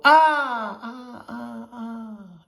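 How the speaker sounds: high loud cry of "Ah" that fades away like an echo; a boy's cry bouncing back from the canyon walls; yell fading to echo. Acted. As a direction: yell it, then let each repeat die off. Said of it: "he yelled".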